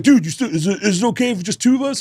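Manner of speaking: high, quick eager dude voice; eager fan. Retold or imitated. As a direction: ask excitedly like a fan.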